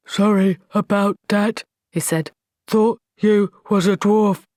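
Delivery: slow, heavy troll voice. Acted. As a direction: speak like a dim, slow troll.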